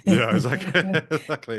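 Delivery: laughing as you concede the joke; laughing agreement. Real, not acted. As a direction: agree through laughter, high-pitched. Joking back after being teased.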